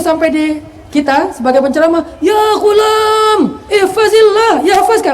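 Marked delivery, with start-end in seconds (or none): 2.2-5.1 high, slow, booming preacher's cry; shouted preacher delivery. Shown as a demonstration. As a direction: boom it out like a fiery preacher. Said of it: "Berubah kan nadanya kan ya". (suara penceramah yang berteriak lantang)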